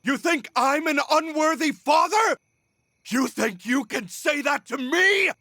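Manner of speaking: high furious shout at the accuser; outraged shout. Acted. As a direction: roar it back, stung and enraged. Villain enraged at being called unworthy.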